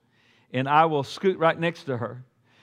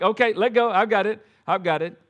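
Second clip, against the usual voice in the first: higher, hurried "I've got it"; a dad calming the new driver while taking over; urgent reassurance; 5 semitones higher than usual. Retold or imitated. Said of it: "I'm just like".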